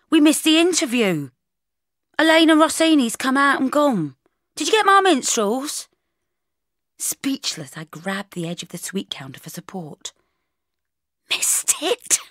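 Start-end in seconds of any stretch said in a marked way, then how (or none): 0.1-5.9 high, loud urgent shouting; yelling. Acted. Camera assistant to Bridget about the missed interview, yelling. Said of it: "he yelled". 11.3-12.2 high, stunned gasped question; breathless shock. Acted. Bridget hearing of the missed interview, breathless shock. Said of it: "as soon as I could steady my breathing".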